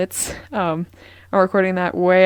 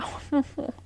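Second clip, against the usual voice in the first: short, breathy laugh; embarrassed laugh; 7 semitones higher, 7 dB quieter than usual. Real, not acted. Podcaster laughing at their own bad accent, short soft laugh. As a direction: laugh softly at your own silliness.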